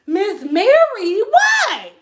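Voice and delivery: high, loud, slow incredulous call; drawing her name out in disbelief; shocked call out. Retold or imitated. The narrator calling to her client in shock, high and loud. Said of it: "I turned around like".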